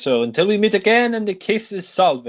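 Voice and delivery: high-pitched imitated farewell; Clouseau impression. Clouseau taking leave of Dreyfus, high hammed-up imitation. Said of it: "he says to, uh, Dreyfus".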